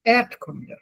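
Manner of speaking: Erdkunde with ERD stressed, kunde dropping; falling Erdkunde, accent on the first syllable. Shown as a demonstration. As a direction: repeat Erdkunde leaning on ERD. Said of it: "accent is on the E".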